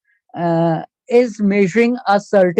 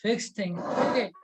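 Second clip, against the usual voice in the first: faint, distant online voice; barely audible; 10 dB quieter than usual. Real, not acted. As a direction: speak too softly for the mic. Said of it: "Sir, your voice is very low".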